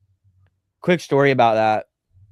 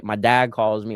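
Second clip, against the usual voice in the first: ordinary talk, nothing marked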